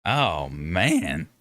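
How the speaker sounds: ordinary delivery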